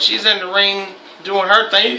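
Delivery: normal talking voice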